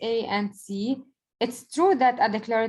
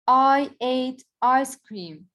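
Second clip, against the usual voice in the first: slow teacher demo, a bit raised; slow example read; 3 semitones higher, 4 dB louder, much slower than usual. Shown as a demonstration. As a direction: spell the sentence out slowly for the class. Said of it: "This is a statement".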